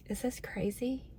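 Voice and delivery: a soft unvoiced whisper; half-embarrassed whisper to the viewers; whispered aside. Real, not acted. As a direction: confide it under your breath.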